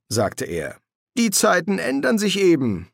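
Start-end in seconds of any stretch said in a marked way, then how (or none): 1.2-2.9 breezy, higher-pitched delivery; higher voice at a normal pace; casual demon's remark. Acted. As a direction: toss it off in a high, easy voice. Crowley's casual reply, later echoed by Hastur.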